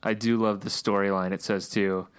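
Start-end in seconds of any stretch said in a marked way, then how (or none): none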